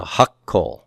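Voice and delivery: hakol with a held double k; doubled consonant. Shown as a demonstration. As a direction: stop on the k in hakol before releasing it. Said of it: "double that consonant's pronunciation".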